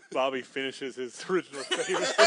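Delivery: normal talking voice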